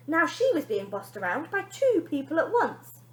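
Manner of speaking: ordinary delivery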